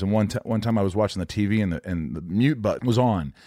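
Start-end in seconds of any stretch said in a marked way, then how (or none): none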